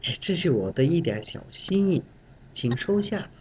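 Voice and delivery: soft, modest offering voice; humble subordinate. Retold or imitated. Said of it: "小张说：".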